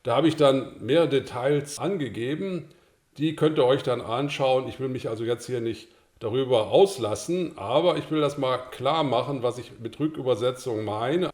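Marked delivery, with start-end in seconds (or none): none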